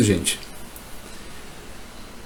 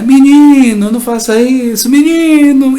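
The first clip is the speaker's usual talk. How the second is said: high, loud scolding yell; father shouting; 13 semitones higher, 6 dB louder than usual. Retold or imitated. Imitating a father yelling at his child. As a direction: yell at the boy to stop. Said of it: "E grita, e grita, e grita".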